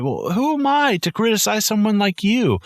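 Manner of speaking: host mimicking Annie flattering the writer, high and syrupy; imitating Annie. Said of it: "She goes over".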